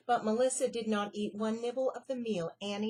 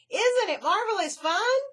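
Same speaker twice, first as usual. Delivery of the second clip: screaming; 10 semitones higher, 12 dB louder than usual. Acted. Melissa screaming over the traffic noise. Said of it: "screamed Melissa".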